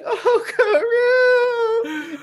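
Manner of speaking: high wailing cry of the duck's name; mock crying Vivi. Retelling the anime, wailing for her fallen duck. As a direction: ham up a tearful princess wail.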